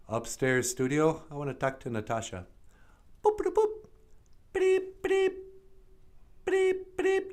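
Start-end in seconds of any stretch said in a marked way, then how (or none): 3.2-7.3 high chirpy computer boops; high robotic boop boop boop; computer beeps. Acted. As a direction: beep like a ship's computer. Computer beeping noises.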